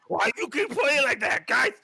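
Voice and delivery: high, loud call to the group; orc shouting out. Acted. Orc calling his group for attention, shouting. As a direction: yell for the others' attention as a worked-up orc. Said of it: "shouting out".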